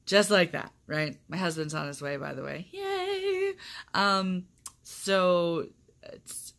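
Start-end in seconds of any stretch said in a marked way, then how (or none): 2.7-3.5 high little yay of delight; excited cheer. Real, not acted. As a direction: cheer that he's on his way. Cheering husband coming home.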